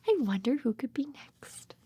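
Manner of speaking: hushed teasing whisper for little ones; suspense whisper. Acted. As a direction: lean in and whisper the mystery. Building suspense for toddlers.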